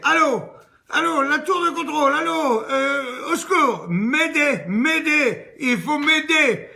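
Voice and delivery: appel au secours bien plus haut et fort; panique. Acted. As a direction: crier à l'aide dans le micro. Passagère paniquée au micro. (passagère paniquée qui appelle la tour)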